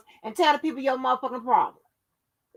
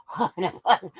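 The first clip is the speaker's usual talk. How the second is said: lower, whimpering mock of the haters; mock whiny huffing; 6 semitones lower than usual. Mocking her haters, she whimpers their huffing. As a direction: mimic them huffing like crybabies. Repeats the phrase, second mocking.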